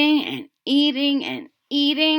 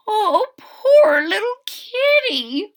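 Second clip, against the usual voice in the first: high, slow, tender cooing; tender pity; 11 semitones higher, 5 dB louder, slower than usual. Acted. Old woman pities the thin kitten, high slow coo. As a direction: coo over the poor kitten. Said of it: "said the very old woman".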